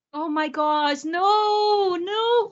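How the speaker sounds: high drawn-out dismay; rising wail of disgusted dread; horrified wail. Real, not acted. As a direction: moan it high in horror. Horrified by the grasshopper story.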